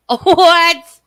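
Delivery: loud, high burst of disbelief; shocked disbelief. Real, not acted. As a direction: blurt it out, loud and incredulous. Disbelief at news read from chat.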